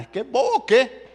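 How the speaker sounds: loud, high, scornful jab; mock indignant wife; scornful outburst. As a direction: scoff at the fool. Jezabel mocking Nabal.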